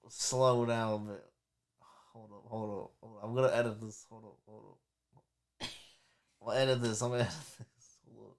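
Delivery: very soft, low and halting. Real, not acted. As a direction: trail off, barely getting the words out. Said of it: "I just got hit with a high".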